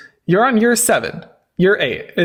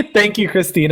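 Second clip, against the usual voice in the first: usual speaking voice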